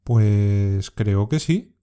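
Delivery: very slow, hesitant "Pues creo que sí.", dragging the "Pues"; unsure, stretching each word while thinking; doubtful. Shown as a demonstration. Teacher modelling doubt for learners, very slow and hesitant. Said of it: "cuando tiene el significado de duda".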